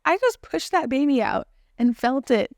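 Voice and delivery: elated disbelief. Retold or imitated. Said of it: "I was laughing uncontrollably because I was like".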